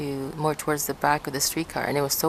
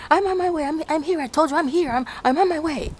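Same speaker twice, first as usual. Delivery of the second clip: imitating an annoyed caller; 11 semitones higher, faster than usual. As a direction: mimic an annoyed man rapidly cutting off a caller. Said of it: "And then he says".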